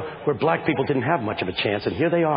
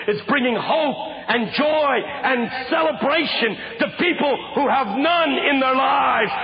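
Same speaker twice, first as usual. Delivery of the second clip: preaching build; 10 semitones higher than usual. Real, not acted. Preacher urging the kids, climbing preaching voice. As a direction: build the sermon to a ringing peak.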